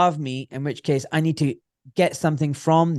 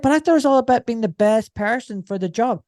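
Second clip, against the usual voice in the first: higher, louder wide-eyed objection; naive protest; 8 semitones higher, 4 dB louder than usual. Retold or imitated. Young surgeon objecting to consultant, higher naive protest. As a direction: protest like a let-down idealist.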